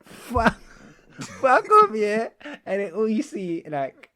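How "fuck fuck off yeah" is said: loud, high yell broken by laughter; imitating a fed-up heckler; imitated angry shout. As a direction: bellow it, cracking up midway. Retelling fan's repeated shouting.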